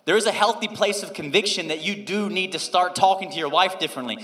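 loud, slow, emphatic preaching; full-voiced pulpit delivery. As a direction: drive it home to the congregation. Preaching to congregation.

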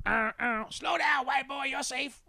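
high, loud holler from a passing truck; imitated old man's yell; old guys hollering. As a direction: shout it out as a mock warning.

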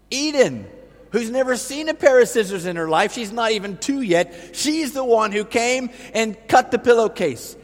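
sarcastic. Real, not acted. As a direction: roll your eyes at the idea. Sarcastic aside about toddler.

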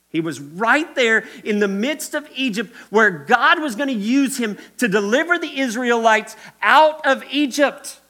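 high, very loud preacher's cry; impassioned preaching. Real, not acted. Preacher telling the church of God's plan for Moses, shouting. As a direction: proclaim it at full force.

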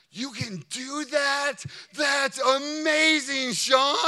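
loud, slow, high amazed exclamation; amazed shout. Retold or imitated. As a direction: yell it out in wide-eyed wonder. Young intern amazed by the friend's boast.

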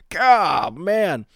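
ordinary talk

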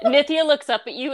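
usual speaking voice

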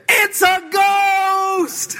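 high, slow, shouted fright; hammed-up scream of a spooked disciple; mock terrified scream. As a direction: scream it like you've seen a ghost. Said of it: "what do they scream".

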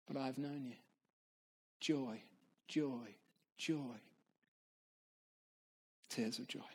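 low, hushed, reverent reading with long pauses; solemn journal reading. Acted. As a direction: read it as a sacred testimony, letting each phrase settle. Reads Pascal's journal aloud.

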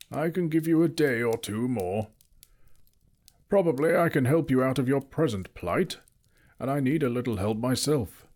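a hint of the wizard. A narrator's reading. Gandalf keeps speaking.

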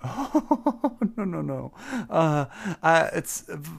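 high, stammering nervous greeting; timid satyr voice. Acted. To the tavern crowd, a high, stammering shy hello. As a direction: squeak out a shy hello at the bar.

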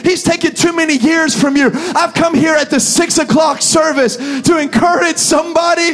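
loud, rising pulpit preaching; full-force preaching. Real, not acted. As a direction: preach it hard and build to a roar.

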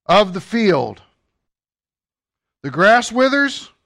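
measured declaiming, swelling on key words; scripture proclamation. Acted. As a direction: proclaim each phrase and let it land. Proclaims scripture with long pauses.

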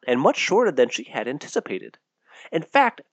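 plain reading voice

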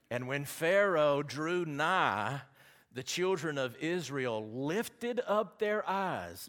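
high, loud, very slow declaiming; forceful scripture reading. Real, not acted. Preacher proclaims Pharaoh's approach to the congregation, forceful scripture reading. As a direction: proclaim the verse with drawn-out, ringing force.

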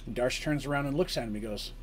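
ordinary talk, nothing marked

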